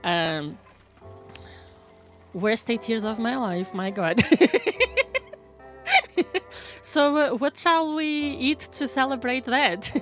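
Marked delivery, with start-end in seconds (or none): none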